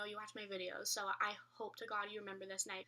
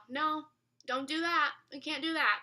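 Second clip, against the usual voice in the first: louder, higher mock telling-off; retold scolding; 5 semitones higher, 8 dB louder than usual. Teen telling off the spooky stuff in the house, scolding. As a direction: firmly forbid it, raised voice.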